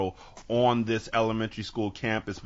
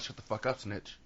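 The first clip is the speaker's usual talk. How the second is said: muttered aside; 10 dB quieter than usual. Acted. Passers-by warning off a snitch, muttered aside. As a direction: drop it under your breath in passing. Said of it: "everybody's walking past like".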